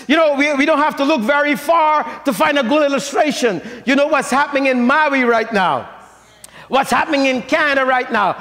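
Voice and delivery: ordinary delivery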